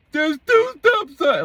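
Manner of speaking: high, drawn-out mock yell; imitated shouting. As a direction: mimic a man yelling from down the street. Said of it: "he's shouting".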